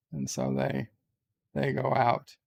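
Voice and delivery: usual speaking voice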